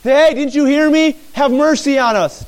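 loud, high shout to be heard; acted yelling. Blind men shouting as Jesus keeps on going, acted yelling. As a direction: yell after someone who keeps walking. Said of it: "and yell and say".

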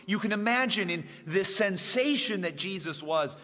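ordinary talk

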